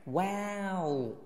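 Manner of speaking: long "wow", open then rounding to "ow"; rounded ending. Shown as a demonstration. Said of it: "要变圆". (慢读"wow"，张大嘴再变圆)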